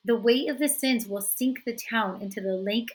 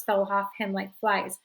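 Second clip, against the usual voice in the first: usual speaking voice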